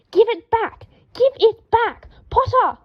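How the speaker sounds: high, loud frantic shout. A narrator's reading. Draco chasing the ferret and calling Harry, frantic shout. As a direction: yell after the thief.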